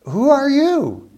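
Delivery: loud, high, drawn-out question; startled demand. Acted. Isaac asks the son who just came in, startled. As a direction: act out a father taken aback, voice leaping up.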